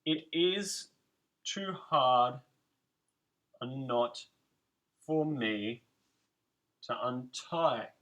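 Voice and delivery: very slow, soft, word by word with pauses; soft counted delivery; slow and separated. Shown as a demonstration. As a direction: mark the beats one by one.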